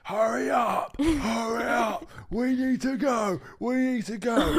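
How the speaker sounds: high, loud, drawn-out nagging yell; hammed-up urgent shriek; mimicked screaming. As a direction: impersonate a girlfriend screaming to hurry. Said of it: "Isabella will be go screaming".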